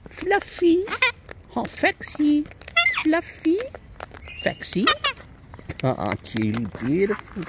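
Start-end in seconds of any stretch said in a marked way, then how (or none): 0.1-3.6 high pet calls; high calling voice. Real, not acted. Owner calling her pet birds, high. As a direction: call the names up high.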